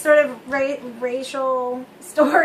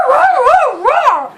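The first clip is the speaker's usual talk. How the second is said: yelped roar roar roar, much higher and louder; excited dog bark; 10 semitones higher, 8 dB louder, much slower than usual. Retold or imitated. Retelling how the family dog answered, barking loudly. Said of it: "would go".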